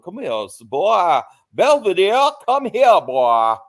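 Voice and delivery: put-on loud, high Southern drawl calling a boy; mock Southern twang calling the boy over; Southern Foghorn Leghorn. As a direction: holler it like a Southern blowhard. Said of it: "The Foghorn Leghorn types".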